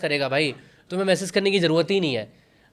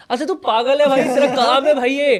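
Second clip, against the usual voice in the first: higher-pitched, mock outraged telling-off; raised, indignant pitch as if arguing; exasperated scolding; 6 semitones higher, 3 dB louder than usual. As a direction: scold an imagined editor in disbelief. Acting out telling off an editor.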